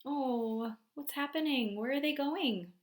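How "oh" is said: usual speaking voice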